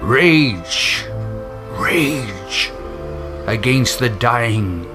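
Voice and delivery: ordinary talk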